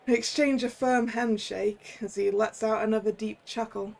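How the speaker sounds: ordinary talk, nothing marked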